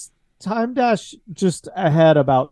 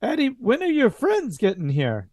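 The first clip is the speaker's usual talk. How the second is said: put-on higher, puzzled old mama asking; 7 semitones higher than usual. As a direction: wonder aloud where his friends are. GM voices Mama Tacconi.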